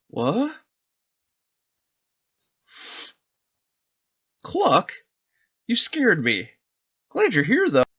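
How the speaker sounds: put-on a kid relieved it's only his dog; relieved little boy.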